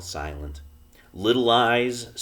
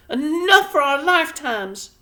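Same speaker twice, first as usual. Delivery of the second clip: very high, louder worried mother; a tiny wife fretting over supplies; Homily's voice; 10 dB louder than usual. A narrator's reading. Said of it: "murmured Homily".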